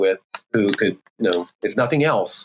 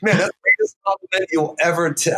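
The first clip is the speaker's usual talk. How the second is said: taken aback; 8 semitones higher than usual. Retold or imitated. Acting out his stunned reaction.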